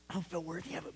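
hushed, breathy confession; barely voiced, humbled whisper. Real, not acted. Preacher whispering to his congregation, humbled.

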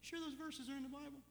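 high, hushed-down doubter's question; mock disbelief. Doubter asking if verses are in Bible, mock disbelief.

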